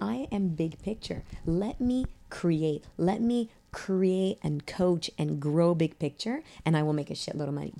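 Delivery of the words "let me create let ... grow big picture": slow, deliberate. Real, not acted. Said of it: "slow emphatic coaching".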